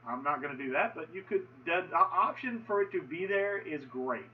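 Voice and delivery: normal talking voice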